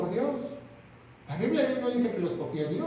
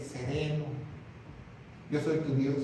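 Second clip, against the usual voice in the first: soothing calm; 6 semitones lower, 7 dB quieter than usual. Acted. God calming his people.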